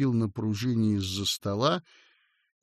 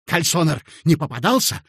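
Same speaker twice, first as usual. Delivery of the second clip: raised, louder sudden query; abrupt question; 4 semitones higher, 6 dB louder than usual. A narrator's reading. Clerk hunting for Kalsoner, abrupt question. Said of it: "отрывисто спросил Коротков".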